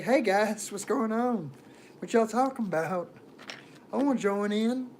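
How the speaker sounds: high, eager mock greeting. Outsider trying to join a conversation, eager mock greeting.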